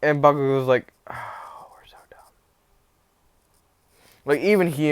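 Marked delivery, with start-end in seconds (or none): 1.1-2.2 hushed sheepish whisper. Acted. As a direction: breathe it out barely voiced. Bakugo admitting their mistake.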